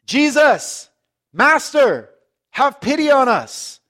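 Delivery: loud, high, drawn-out plea; calling out loudly. Acted. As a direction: cry out for help across a distance. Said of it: "they call out in a loud voice".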